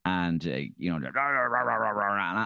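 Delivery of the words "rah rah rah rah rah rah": mock bickering babble; wordless mimicry of a squabble; imitated arguing noise. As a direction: run the syllables together like a row.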